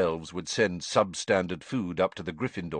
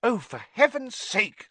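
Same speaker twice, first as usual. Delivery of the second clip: higher, exasperated outburst; angry Hermione; 13 semitones higher, 3 dB louder than usual. Acted. Hermione angrily scolding the house elves. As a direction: snap it out in frustration. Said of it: "said Hermione angrily".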